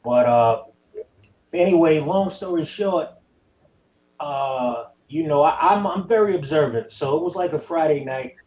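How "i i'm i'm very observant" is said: usual speaking voice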